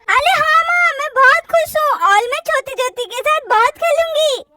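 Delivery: put-on high, lisping excited child; gleeful little-kid voice; little girl Chutki, happy. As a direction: babyish delight about playing with a cousin.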